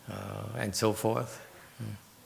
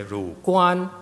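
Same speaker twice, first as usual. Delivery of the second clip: "Ru Guan" higher and louder, Chinese tones; Chinese stress; 9 semitones higher, 7 dB louder than usual. Shown as a demonstration. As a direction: say "Ru Guan" with Chinese pronunciation, raised.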